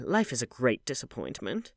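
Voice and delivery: plain reading voice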